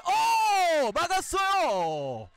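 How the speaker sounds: loud burst at a great save; excited shout. Real, not acted. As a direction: yell it out, thrilled by the save. Shot saved, commentator shouts.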